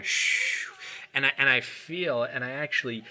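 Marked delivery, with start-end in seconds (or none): 0.0-0.7 rounded-lip shh, breathed out; shh through rounded lips. Shown as a demonstration. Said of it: "I put a".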